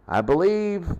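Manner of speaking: normal talking voice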